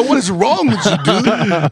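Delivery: high, laughing question; laughing disbelief. Retold or imitated. Teammate laughing at a hungover quarterback shooting par, laughing. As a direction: crack up while asking it. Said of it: "And I go".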